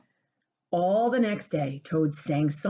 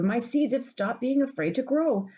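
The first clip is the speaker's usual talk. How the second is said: joyful shout; 8 semitones higher than usual. Acted. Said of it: "shouted Toad".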